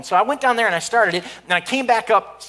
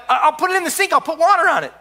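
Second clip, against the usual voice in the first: flustered; 8 semitones higher, 3 dB louder, faster than usual. Retold or imitated. Panicked plan.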